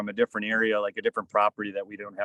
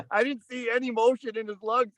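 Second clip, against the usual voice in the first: ordinary delivery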